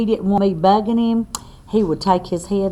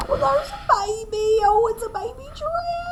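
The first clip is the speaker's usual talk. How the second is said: delighted cooing. Acted. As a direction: gush over the baby animal. Delighted at baby giraffe picture.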